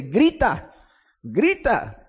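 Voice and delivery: an understated quoted cry, rising then falling; emphatic cry. Retold or imitated. Preacher quotes God's order to the prophet, mild cry. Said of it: "en otras traducciones dice".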